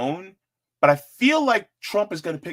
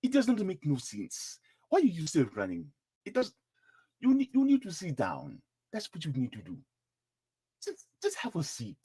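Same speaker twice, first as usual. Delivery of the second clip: softer scolding in put-on African accent; best African accent; 7 dB quieter than usual. Telling Nikki to sit down, in a put-on African accent. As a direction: chide in a mock African accent. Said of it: "in my best African accent".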